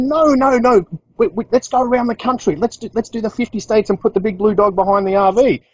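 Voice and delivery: excited wife pitching a fifty-state road trip, mimicked high; imitating the wife. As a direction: talk fast and keen like she's selling the plan.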